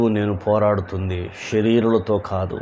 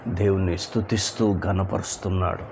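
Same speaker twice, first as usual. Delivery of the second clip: ordinary talk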